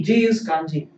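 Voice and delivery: ordinary delivery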